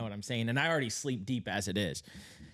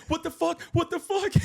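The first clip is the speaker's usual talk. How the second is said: very high, loud repeated panic; panicked shriek; 6 dB louder than usual. Retold or imitated. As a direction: squeal in terror. Grown men panicking.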